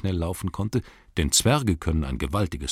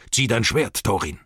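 urgent call to a companion, starting raised; called-out command; 3 semitones higher than usual. A narrator's reading. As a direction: order your companion to arms. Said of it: "rief Gandalf".

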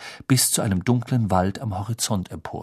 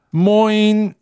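high, loud, chirpy hello; cheerful greeting; 12 semitones higher, 9 dB louder than usual. A narrator's reading. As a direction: greet the Muggle brightly. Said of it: "sagte Mister Weasley munter".

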